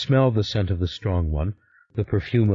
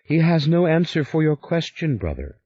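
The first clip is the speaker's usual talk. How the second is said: sister's cry, much higher; sharp cry at her brother, raised; sister cries out; 9 semitones higher than usual. A narrator's reading. Sister mocking father to her brother, crying out higher. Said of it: "cried Emeleth".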